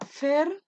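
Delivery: Fértil split into FER, long pause, TIL; syllable by syllable. Shown as a demonstration. Teacher separating the word fértil, syllable by syllable. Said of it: "esto de separar".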